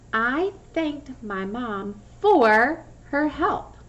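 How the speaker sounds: slow, careful sentence stressing for; slow model sentence. Shown as a demonstration. Teacher reading a slow example sentence with for. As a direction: read it slowly so for stands out. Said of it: "Did you hear my word for in the sentence".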